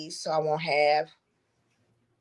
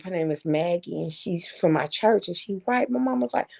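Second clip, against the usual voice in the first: ordinary talk, nothing marked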